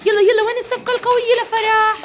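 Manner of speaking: high-pitched call for applause; excited, sing-out teacher cheer; rallying cheer. Real, not acted. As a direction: rouse the class for a big clap. Rallying class to clap.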